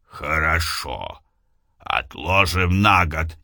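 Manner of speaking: lightly voiced distant lover; low beloved voice. Голос любимого. (низкий голос возлюбленного)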